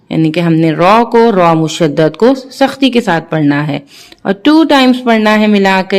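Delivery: ordinary talk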